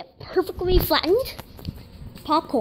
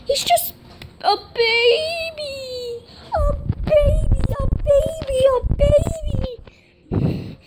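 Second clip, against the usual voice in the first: high, slow cooing voice; 8 semitones higher, slower than usual. Real, not acted. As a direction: coo it slowly to the pet. Cooing over the cat.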